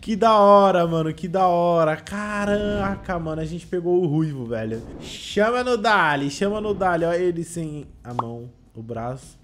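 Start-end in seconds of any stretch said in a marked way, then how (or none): 0.0-1.9 loud joyful cheer; excited. Real, not acted. Celebrating lucky pull. 1.9-7.0 excited. Real, not acted. Celebrating getting Shanks.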